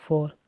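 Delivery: फॉर with the final R sounded and stressed; फॉर, R pronounced with weight. Shown as a demonstration. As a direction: lean on the R at the end of फॉर. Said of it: "उसके ऊपर बल दिया जा रहा है आर के ऊपर".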